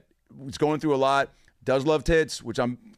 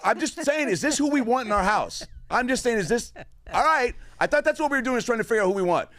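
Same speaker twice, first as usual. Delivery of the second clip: high-pitched, insistent self-defense; re-enacted defensive protest, pitched way up; mock indignant protest; 12 semitones higher than usual. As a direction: plead innocence over the shouting. Acted protest against outraged brothers.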